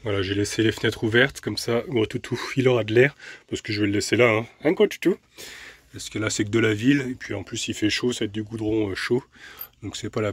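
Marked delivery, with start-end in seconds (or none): 4.6-5.2 pet talk. Real, not acted. Owner teasing her big dog, higher pet talk. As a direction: coo a question at the dog.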